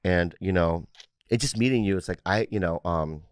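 low, soft, earnest apology; mock sincere. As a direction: lay on the fake sincerity, quietly.

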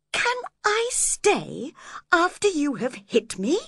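high, affronted question; wounded reproach. A narrator's reading. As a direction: ask it in hurt disbelief. Hurt man replies after being hit.

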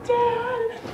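high imitated cry for Dad; hammed-up high call. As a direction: call out like a startled daughter. Imitates girl waking, calling father.

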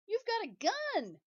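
high, loud burst of surprise; loud, high excited exclamation; excited cry. Acted. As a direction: blurt it out, thrilled. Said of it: "Mat cried".

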